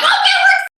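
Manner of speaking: very high, loud squeal imitating friends; hammed-up screech of forced enthusiasm; mock excitement.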